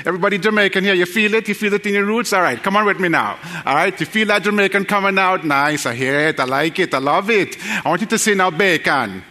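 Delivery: rally the room in a lilting Jamaican accent. Shown as a demonstration. Preacher getting the congregation to play along, put-on Jamaican accent. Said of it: "Now pretend you're Jamaican".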